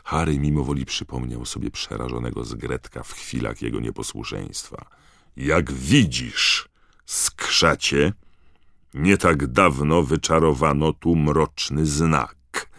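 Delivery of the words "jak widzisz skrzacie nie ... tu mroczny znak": slow, loud interrogator; stern. A narrator's reading. Digory laying out the case to the elf, stern. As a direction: spell it out sternly and deliberately. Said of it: "powiedział pan Digory surowym tonem".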